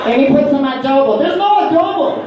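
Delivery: high, indignant comic rant; exasperated protest. Acted. Husband objecting to extra seasoning, high exasperated protest. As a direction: protest the added ingredient at high pitch. Ranting about added ingredients.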